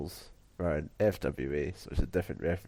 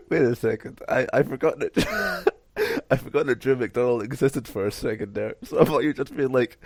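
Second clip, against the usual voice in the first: laughing; 6 semitones higher, 6 dB louder than usual. Real, not acted. Laughing at misunderstanding.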